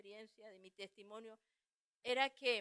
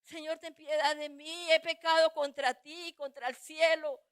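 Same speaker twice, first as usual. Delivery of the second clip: loud, raised-pitch cry for mercy; re-enacted anguished plea; humbled pleading prayer; 3 semitones higher, 11 dB louder than usual. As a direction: beg God at full voice, desperately. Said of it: "me humillé y le dije".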